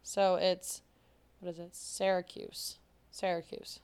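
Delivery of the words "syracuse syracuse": Syracuse slowly, first syllable like "sair"; correct Syracuse. Shown as a demonstration. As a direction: model SAIR-uh-kyooss for Syracuse. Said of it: "that's what it's supposed to be".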